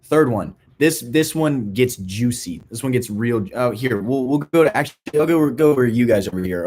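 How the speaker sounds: ordinary delivery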